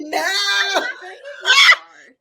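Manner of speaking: loud, high, laughing shout of a fake promise; mimicking the opponent's promise, loud and high with laughter; laughing mock reply. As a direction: shout the lie through laughter. Mocking opponent's lie.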